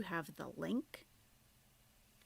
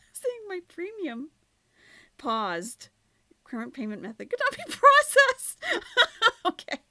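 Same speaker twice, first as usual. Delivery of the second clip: high loud laughing read; 14 semitones higher, 13 dB louder than usual. Real, not acted. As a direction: laugh while reading it out. Reads error message laughing.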